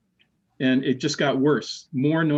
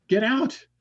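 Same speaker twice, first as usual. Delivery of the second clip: much higher, rising retold warning; urgent warning; 9 semitones higher than usual. Ex-wife on the phone urges him to leave, high imitated warning. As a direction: pitch it up as an imitated urgent order.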